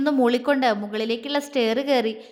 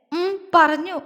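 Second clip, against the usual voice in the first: higher-pitched, lightly acted tearful reply; sobbing; 5 semitones higher, 3 dB louder, slower than usual. A narrator's reading. Girl admitting she was told about Lachu, mildly sobbing. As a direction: answer as if holding back a sob. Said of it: "അവൾ തേങ്ങി".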